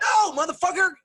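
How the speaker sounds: very high, loud yell of protest; outraged. Real, not acted. Critic yelling back at the film, outraged. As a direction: shout down what was just said. Outraged answer to played apology.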